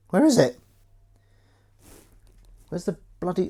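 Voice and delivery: "Where is it?" jumps very high and loud; frantic searching squeal; squeaky high frustrated cry. Real, not acted.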